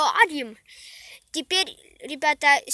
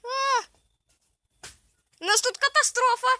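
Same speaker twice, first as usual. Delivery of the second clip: panicked cry; 9 semitones higher than usual. Real, not acted. Fire spreading, kid panics.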